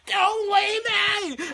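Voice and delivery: high, loud, drawn-out plea; hammed-up desperate wail; screaming. She begs him to stay, screaming. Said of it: "so they're screaming".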